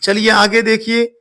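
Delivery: ordinary talk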